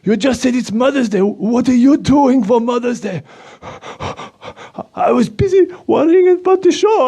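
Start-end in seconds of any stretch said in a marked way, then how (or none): 4.9-7.1 worried. Acted. Puppet panicking over forgotten gift.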